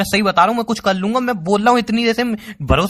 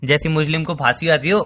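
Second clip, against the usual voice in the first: ordinary talk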